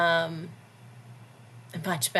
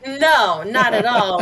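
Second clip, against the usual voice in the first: loud, higher-pitched flat-out denial; emphatic refusal; 5 semitones higher, 11 dB louder, slower than usual. Real, not acted. Answering whether she wants advice in the moment, emphatic denial. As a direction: burst out with an amused, forceful no.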